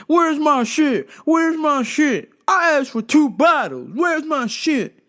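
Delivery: high, loud, slow "Where's my shit?"; slow high insistent mock demand; mock demanding customer. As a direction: hammer it like an entitled customer. Customer demanding his order.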